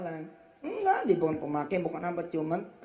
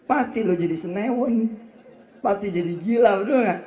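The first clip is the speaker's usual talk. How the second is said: 1 raised preaching voice; 6 semitones higher, 7 dB louder than usual. Real, not acted. Preacher raising voice jokingly.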